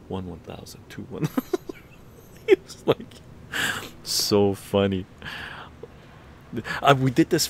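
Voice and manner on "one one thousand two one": quiet counting. Retold or imitated.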